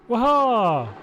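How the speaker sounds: loud, high drawn-out "waha"; delighted whoop. Real, not acted. Greeting a viewer who finally turned up, delighted whoop. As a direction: let out a big falling whoop.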